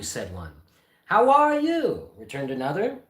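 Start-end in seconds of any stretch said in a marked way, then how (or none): 1.1-2.1 much higher, slow, drawn out; a pompous reply stretched long; hammed-up fussy gentleman; high slow greeting. Second answers greeting.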